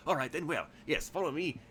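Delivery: hint of a Cockney shopkeeper ushering customers; mild Cockney host; Cockney-tinged tailor. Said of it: "he's really Cockney instead of".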